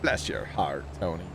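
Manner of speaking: lightly Southern, sweetly cutting; Southern twang. Shown as a demonstration. Teasing a co-op partner in a mild Southern accent. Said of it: "I don't know why I put the twang too".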